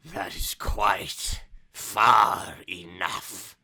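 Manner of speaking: slow, low, forceful rasp; very raspy old man. Acted. Ancient man stopping intruders at his door, slow and raspy. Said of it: "he speaks in a very raspy voice".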